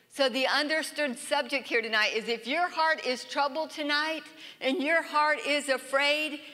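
ordinary talk

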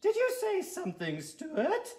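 very high-pitched prim lady teacher; schoolteacher voice. Acted. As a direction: quiz the class in a high falsetto. Teacher questions pupil.

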